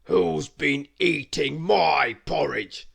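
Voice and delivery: loud, slow demanding bear; loud, slow gruff question; gruff big bear voice. A narrator's reading. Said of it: "a loud, gruff voice".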